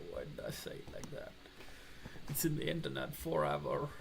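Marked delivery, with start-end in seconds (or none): 0.1-1.3 low, fast voiceless whisper of the same line. Shown as a demonstration. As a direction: mutter it to yourself in a whisper.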